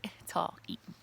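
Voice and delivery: hushed amused whisper; whispered joke. Real, not acted. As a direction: whisper the punchline.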